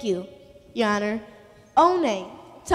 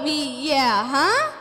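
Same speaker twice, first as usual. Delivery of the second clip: ordinary talk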